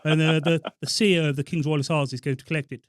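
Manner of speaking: put-on colonel, loud and commanding; officer's prank-call voice, projected; Colonel Tarquin. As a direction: play the army colonel asking a favour. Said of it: "You done spot on the voice".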